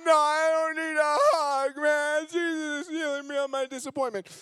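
half-tearful no thanks; brushing off. Retold or imitated. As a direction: wave off the hug while sobbing. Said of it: "I was like".

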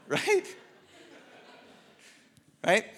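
usual speaking voice

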